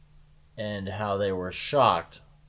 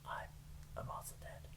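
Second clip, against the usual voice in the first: whispering; 21 dB quieter than usual. Pretend. Whispered mock-dramatic ending.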